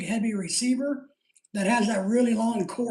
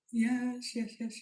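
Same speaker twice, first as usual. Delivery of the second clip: ordinary delivery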